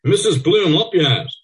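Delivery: high, loud, slow hail; loud call. A narrator's reading. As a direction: call out for all to hear. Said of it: "calls loudly for all to hear".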